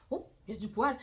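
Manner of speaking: plain reading voice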